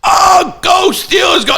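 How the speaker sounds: very high, excited mock shout; imitated announcer. The guy at the end of the game, imitated high shouted cheer. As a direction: cheer the team on like a homer announcer. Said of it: "the guy goes".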